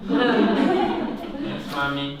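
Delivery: playful mock obedience; mock-obedient child. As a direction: answer like a scolded child, amused. Plays obedient child to scolding.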